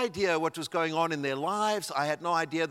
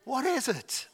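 startled, high question with a pitched-up voice; surprised question; 9 semitones higher, slower than usual. Retold or imitated. Teen puzzled as mentor stalls, asking in a surprised high voice. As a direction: jump up in pitch, caught off guard.

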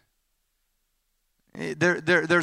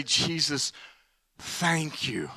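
soft, low heartfelt whisper of thanks; quiet sincere thank you; tender thanks; 3 semitones lower, 8 dB quieter, much slower than usual. Pretend. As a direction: breathe it with gratitude.

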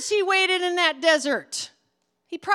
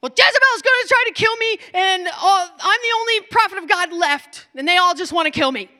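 fast, loud, higher rattle-off; rehearsed rant; 4 semitones higher, 5 dB louder than usual. Acted. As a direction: fire it out like a rehearsed speech. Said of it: "rehearsed it a hundred times".

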